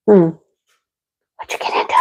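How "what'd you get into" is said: soft whispered question. Real, not acted. Whispering to the sick dog about what she ate.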